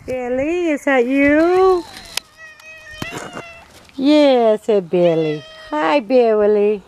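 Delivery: high, loud pet-greeting voice; talking to a pet. Real, not acted. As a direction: call sweetly to the cat. Greeting her other cat.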